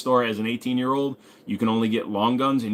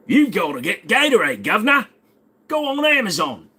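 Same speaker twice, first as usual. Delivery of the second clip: much higher, hammed-up English accent; a clumsy mock English accent, higher; bad English accent; 10 semitones higher, 6 dB louder than usual. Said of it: "I can't do an English accent anymore".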